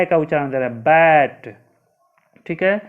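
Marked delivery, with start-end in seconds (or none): 0.7-1.6 बैट said loud, a short ae vowel; sounds out bat. Shown as a demonstration. Teacher demonstrating how BAT is pronounced, loud and clear. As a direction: punch बैट out clearly for the class. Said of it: "ये क्या उच्चारण दे रहा है".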